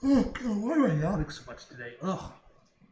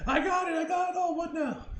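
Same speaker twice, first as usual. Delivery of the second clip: high, loud gleeful yelling; real delighted shout; excited triumph; 11 semitones higher, 5 dB louder than usual. Real, not acted. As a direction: shout the win out. Triumphant in game.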